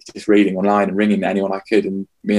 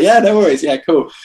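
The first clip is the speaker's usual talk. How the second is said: fake breezy; 8 semitones higher, 5 dB louder than usual. Feigned breezy reply to council.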